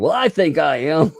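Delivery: high, loud put-on protest; mock insistence. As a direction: insist like a miffed wannabe. Mocking his insistence.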